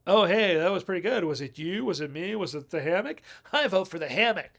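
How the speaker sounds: playful Braids. Retold or imitated. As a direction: tease him like a sassy girlfriend. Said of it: "That's what Braid says".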